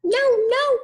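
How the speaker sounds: very high squeaky refusal; little pig voice. A narrator's reading. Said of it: "said the little pig".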